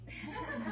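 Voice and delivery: a short soft teasing laugh. Real, not acted. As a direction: laugh to soften the threat. Teasing laugh after threat.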